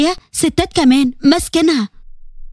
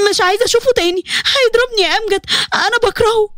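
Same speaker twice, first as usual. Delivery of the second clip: frightened; 6 semitones higher than usual. A narrator's reading. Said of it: "دارين ماسكة في أمجد وخايفة قوي".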